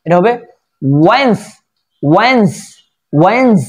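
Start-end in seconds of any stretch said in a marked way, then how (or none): 1.1-3.6 ওয়েন্স as one long "wenz", rising then falling; British drawn-out vowel. Shown as a demonstration. Teacher showing the correct British way, drawn-out vowel. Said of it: "এখানে টান আছে British উচ্চারণে".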